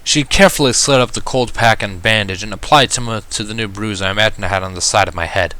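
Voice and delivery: ordinary delivery, nothing marked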